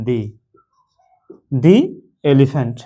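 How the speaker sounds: "দি elephant" with a long ee in THE; careful model of "দি elephant", THE rhyming with "see"; THE before a vowel. Shown as a demonstration. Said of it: "তাহলে এর উচ্চারণ হবে".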